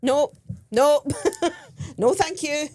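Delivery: high, loud mock refusals breaking into a laugh; retold emphatic refusal. Tourist wary of scam, retold loud high refusal.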